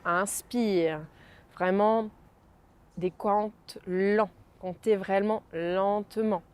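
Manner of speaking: soft, slow, spaced counting; guided breathing count. Acted. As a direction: count each breath calmly and slowly. Counts breaths slowly, softly. (décompte lent et doux de respiration)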